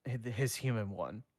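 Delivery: ordinary delivery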